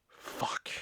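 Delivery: dismayed curse under the breath; hushed, voiceless swear; whispered curse. Retold or imitated. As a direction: whisper it to yourself, defeated. Cursing after customer refuses rental.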